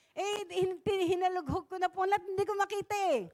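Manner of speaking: lightly voiced complaining kid, fast; whiny sulking youth. Preacher imitating the youth who lost a notebook, fast mild whine. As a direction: rush the complaint like a frustrated teen. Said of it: "Ganun na ganun".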